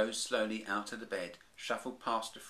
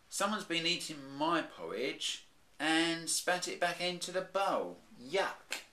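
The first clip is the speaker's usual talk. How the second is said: high, slow, mildly disgusted mum bear; a mother bear pulling a face at the porridge; Mummy Bear voice; 9 semitones higher, 5 dB louder, slower than usual. Acted. Mummy Bear finding spat porridge, mildly disgusted.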